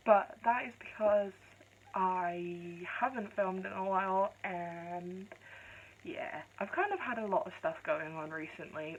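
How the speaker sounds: ordinary talk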